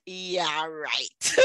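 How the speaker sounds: low, dry sarcastic scoff; mocking disbelief. Ex-partner scoffing at her choosing Jesus, low sarcastic drawl. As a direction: brush her off like a joke. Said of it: "'Cause he's like".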